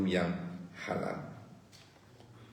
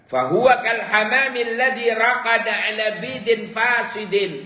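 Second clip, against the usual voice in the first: high, loud chanted declamation of the Arabic saying; impassioned preaching; 13 semitones higher, 8 dB louder than usual. Acted. Preacher declaiming the rotten egg proverb to listeners, impassioned and loud. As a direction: cry the proverb out to the congregation.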